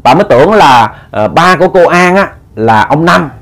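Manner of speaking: plain reading voice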